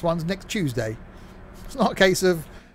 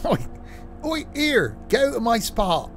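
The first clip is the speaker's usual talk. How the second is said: much higher, hammed-up Scottish farmer shouting oi; mock grumpy local; 8 semitones higher than usual. Train game streamer voices a grumpy local chasing someone off. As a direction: shoo them off your spot in a put-on voice. Shooing someone from his spot.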